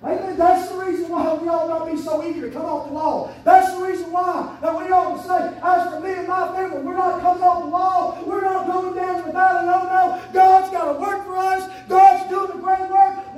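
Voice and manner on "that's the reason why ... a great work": loud preacher's shout, slowing; fiery preaching. Acted. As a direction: preach it to the rafters. Preaching with force.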